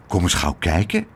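usual speaking voice

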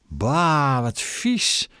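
drawn-out, high-pitched yuck; disgusted. A narrator's reading. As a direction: recoil in disgust at something filthy. Disgusted reaction to the chamber pot. (vol walging, hoog uitgeroepen)

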